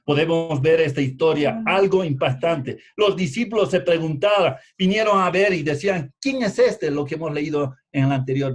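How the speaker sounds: loud, driving sermon delivery; impassioned pulpit storytelling; forceful preaching. Real, not acted. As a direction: project it to the whole congregation, building to a high question. Preaches at raised volume.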